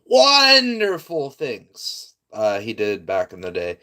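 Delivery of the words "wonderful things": high, loud sarcasm with a long drawn-out "wonderful"; sarcastic. Real, not acted. Streamer mocking the deeds of a historical villain, heavily sarcastic. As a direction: gush it slowly in an exaggerated sing-song. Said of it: "That was sarcastic".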